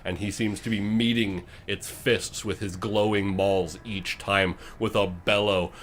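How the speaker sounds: usual speaking voice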